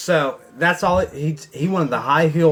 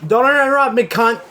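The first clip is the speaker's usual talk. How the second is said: loud, high snap at someone interrupting; raised-voice rebuke; angry yell; 6 semitones higher, 4 dB louder than usual. Real, not acted. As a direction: yell it sharply as a real outburst. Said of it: "I'm sorry, I yelled".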